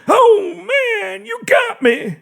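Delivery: mock dying cry; overly dramatic cry. A narrator's reading. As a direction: ham up being shot. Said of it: "in an overly dramatic manner".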